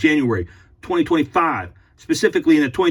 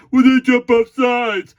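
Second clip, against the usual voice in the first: whiny fan; 7 semitones higher, 6 dB louder, slower than usual. Retold or imitated. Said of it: "And then you say".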